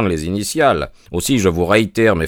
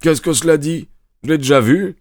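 protesting; 6 semitones higher than usual. A narrator's reading. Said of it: "protesta Lestrade".

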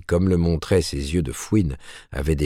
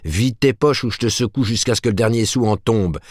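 higher, forceful demand; raised threatening shout; 5 semitones higher than usual. A narrator's reading. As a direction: shake the money out of him with your voice. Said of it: "s'écria Martin".

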